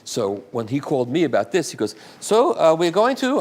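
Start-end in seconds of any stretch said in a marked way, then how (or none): 2.2-3.4 put-on higher, louder German-accented voice; put-on German accent, pitched up. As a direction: imitate an excited German caller. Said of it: "I'll do my German accent".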